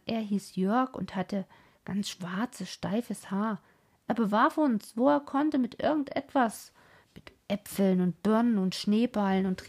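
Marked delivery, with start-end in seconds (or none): none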